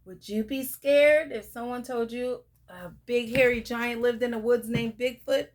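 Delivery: plain reading voice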